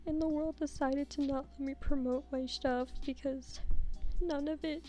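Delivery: high-pitched, whiny plea for help; mock despair. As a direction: play up helpless misery, pitch rising. Mock despair over failed voiceover recording.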